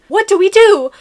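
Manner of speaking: high-pitched panicked-line yell. A narrator's reading. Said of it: "you yell".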